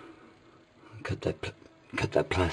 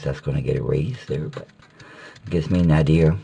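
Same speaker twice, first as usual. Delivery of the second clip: ordinary talk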